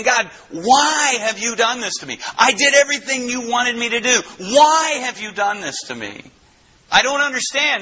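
high-pitched, impassioned protest; accusing God. Acted. Preacher acts Job asking God why, high accusing protest. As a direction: plead high and insistent, demanding an answer. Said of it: "pointing the finger back at God".

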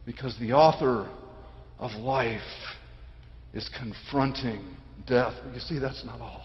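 very soft, low and measured; grave hush. Real, not acted. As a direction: let it sink almost away, pausing between phrases. Grave hush after climax.

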